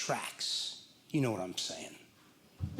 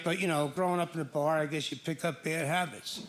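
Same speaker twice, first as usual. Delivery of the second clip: ordinary delivery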